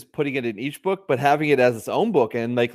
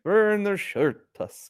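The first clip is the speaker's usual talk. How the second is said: slow, higher half-orc voice; 7 semitones higher, much slower than usual. Acted. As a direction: announce your name as a half-orc. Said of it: "So that's your half-orc voice?".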